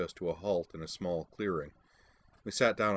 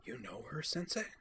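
hushed question, much softer; whispered; 6 semitones higher, 7 dB quieter than usual. A narrator's reading. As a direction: breathe it out under your breath. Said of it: "then whispered".

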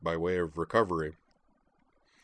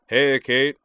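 big, loud, high call across the table; booming greeting; 6 semitones higher, 10 dB louder than usual. Acted. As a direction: boom it out like a giant. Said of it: "he boomed in a voice that matched him".

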